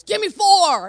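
loud, high stern count; firm dad command. Retold or imitated. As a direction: bark the push-up count at the boy. Dad counting push-ups firmly.

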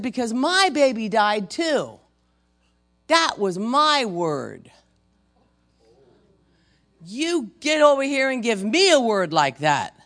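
loud, high, indignant outburst; imitated screaming woman. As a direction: yell the accusation, leaning hard on the words. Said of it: "Screaming bloody murder".

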